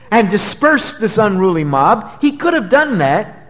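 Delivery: fervent sermon build; preaching. Acted. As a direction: drive the point home from the pulpit. Preacher building to full force.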